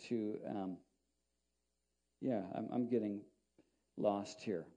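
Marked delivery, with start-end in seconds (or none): none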